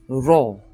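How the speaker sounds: Ro with the R tapped and rolled. Shown as a demonstration. Teacher repeats the rolled R for learners. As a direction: give Ro a clear rolled R. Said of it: "how we're rolling our R".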